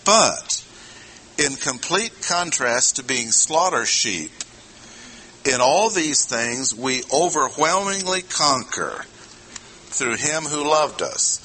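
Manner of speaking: strong "But", slowing on "through him who loved us"; emphatic contrast. Shown as a demonstration. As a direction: hit "But" hard as a total turn. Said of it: "introducing total contrast".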